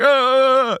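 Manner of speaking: high, sustained imitated spirit wail; Bonnie's drawn-out cry. Spirit leaving the patient's body, mock wail. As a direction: let out a long high "ah". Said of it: "eventually Bonnie's like".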